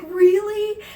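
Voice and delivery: very high, loud surprised squeal; excited disbelief. Retold or imitated. Told she can get into the show, excited disbelief. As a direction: burst out in amazed delight. Acted reaction to getting in.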